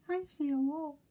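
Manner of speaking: high, hushed pig warning; nervous warning. Acted. Winky spotting the wolf, high and hushed. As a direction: warn the brothers in a high hush.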